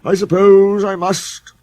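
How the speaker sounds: high, slow and loud sulking; sulky. A narrator's reading. Sir Handel sulkily agreeing to go first. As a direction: give in with a heavy sulk. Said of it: "said Sir Handel sulkily".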